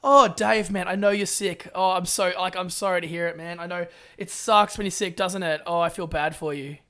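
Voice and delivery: low, soothing commiseration; mock-earnest sympathy; sympathetic. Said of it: "I'm always gonna say".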